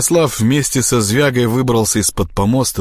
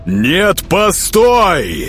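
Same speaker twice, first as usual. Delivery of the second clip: raised, high desperate command; roaring; 10 semitones higher, 4 dB louder, much slower than usual. A narrator's reading. Prince stopping the departing traitor, roaring. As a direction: roar after him to stop. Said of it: "взревел Всеволод".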